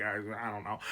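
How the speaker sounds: unsure, backing away from the offer; hesitant Dany. Retold or imitated. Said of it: "Dany's like".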